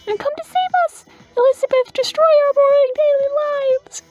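high-pitched, gushing fan voice; excited sparrow fans. Acted. Sparrow fans thrilled to see their idol, high excited voice. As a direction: squeal like starstruck little birds.

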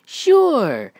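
high, loud, drawn-out sure; eager agreement. Acted. Deadpool agreeing to go on an adventure, eager. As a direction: jump at it, pitch way up.

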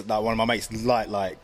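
ordinary delivery

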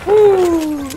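loud high victory whoop; excited whoop. Real, not acted. Gamer cheering after surviving a close fight, high whoop. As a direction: cheer like you just survived.

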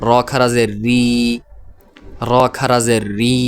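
closing রি, হি, জি each held long; one-alif stretch. Shown as a demonstration. Teacher models khara zer, final রি, হি, জি stretched. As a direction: spell evenly, then draw out the final রি, হি or জি. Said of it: "শুধুমাত্র এক আলিফ টান হবে".

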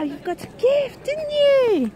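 ordinary talk, nothing marked

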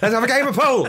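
a bright shout from the top of the stairs; cheery pub punter. Acted. Acting out a punter upstairs.

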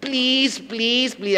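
mock girlish begging; high pleading. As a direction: beg in a rising plea.

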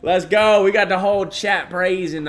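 loud, high cheer; celebratory shout; excited. Real, not acted. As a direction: hype it up.

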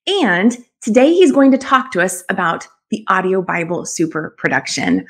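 ordinary talk

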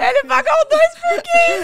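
high, loud, slow shout through real laughter; laughing shout. Real, not acted. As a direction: scream it out in disbelief while laughing. Host cracks up at cheap date.

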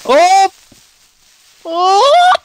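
high, loud panicked yelp; alarmed cry. Real, not acted. Player yelling as a creeper attacks the wolf, panicked.

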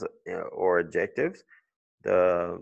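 usual speaking voice

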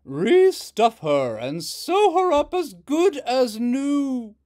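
slow, very loud mother; rumbling. Acted. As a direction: order the kids from above. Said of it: "rumbled Hazel's mother".

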